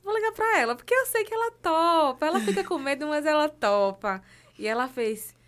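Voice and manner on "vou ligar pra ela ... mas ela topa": excited retelling. Retold or imitated. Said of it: "depois eu disse".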